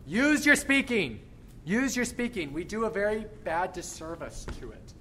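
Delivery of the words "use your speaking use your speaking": coaching call. Real, not acted. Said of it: "coach urging the choir".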